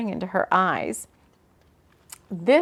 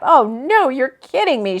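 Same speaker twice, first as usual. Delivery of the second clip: higher, louder disbelief; incredulous; 4 semitones higher, 7 dB louder than usual. A narrator's reading. Woman arriving at an unexpected place, incredulous. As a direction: exclaim it in disbelief. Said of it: "she said incredulously".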